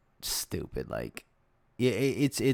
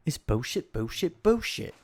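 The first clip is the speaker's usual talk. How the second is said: higher, sing-song bullshit; mocking repetition; 5 semitones higher than usual. Real, not acted. Ranting to viewers about dealers, sing-song disgust. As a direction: chant it in disgust.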